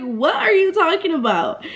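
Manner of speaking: high-pitched retold disbelief; incredulous retort. Retold disbelief at apology demand.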